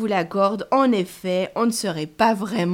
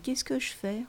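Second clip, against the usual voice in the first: plain reading voice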